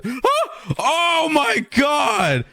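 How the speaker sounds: sudden high shriek, then a slow drawn-out oh my God; startled scream. Real, not acted. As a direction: jumps out of his skin for real. Fire startles him again.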